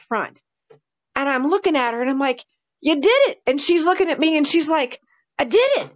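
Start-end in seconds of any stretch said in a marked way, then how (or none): 2.8-3.4 high, excited praise; retold proud cheer. Handler praising her dog after the jump, high and excited. 5.4-5.9 high, delighted reply; proud dog's voice. Retold or imitated. Dog answering its handler after the jump, high and proud.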